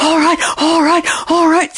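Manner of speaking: winded lion struggling to keep up; out-of-breath lion. A narrator's reading. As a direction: give in between pants. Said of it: "very out of breath".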